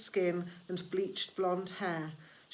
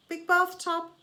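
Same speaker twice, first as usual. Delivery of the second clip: much higher and louder, disbelieving; sarcastic disbelief; incredulous; 9 semitones higher, 6 dB louder than usual. A narrator's reading. Charon doubting Grover's story, loud incredulous.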